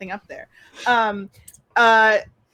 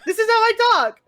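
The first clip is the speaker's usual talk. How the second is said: high-pitched, defensive protest; indignant protest; 10 semitones higher, 3 dB louder than usual. Acted. Character challenged about their way of talking, indignant. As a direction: protest that your odd voice is normal.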